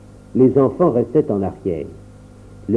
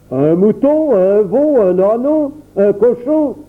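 moquerie enjouée; 8 semitones higher, 3 dB louder, slower than usual. A narrator's reading. Le papa énumère en riant.

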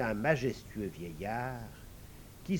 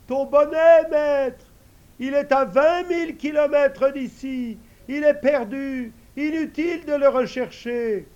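much higher, louder eager servant voice; invisible servant; 11 semitones higher, 12 dB louder than usual. Acted. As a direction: answer the master high and ready. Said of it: "la voix lui répond".

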